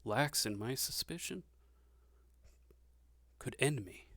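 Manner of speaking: softly, Vargas leans close and lowers her voice; leaning in, hushed. Acted. Lady leans in to warn the half giant, hushed. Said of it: "she kinda leans in and goes".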